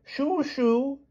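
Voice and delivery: high, dotty shooing; exclaiming. A narrator's reading. King shoos the beast from ravine, exclaiming. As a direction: shoo it off like a hen. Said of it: "he exclaimed, marching to the edge".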